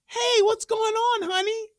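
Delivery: sweet inquiry. Retold or imitated. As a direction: ask sweetly, playing innocent. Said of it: "That's me parting the curtains".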